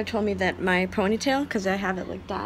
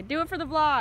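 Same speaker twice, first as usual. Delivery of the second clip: higher-pitched vlogger catchphrase; David Dobrik; 5 semitones higher, 3 dB quieter than usual. Acted. Quoting a vlogger to coax the dog, light impression. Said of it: "David Dobrik would say".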